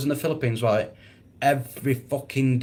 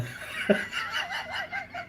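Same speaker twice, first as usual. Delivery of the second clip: high squeaky laugh; 10 dB quieter than usual. Real, not acted. Laughing at the Facebook ban.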